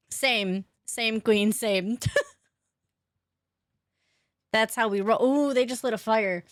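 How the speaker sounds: usual speaking voice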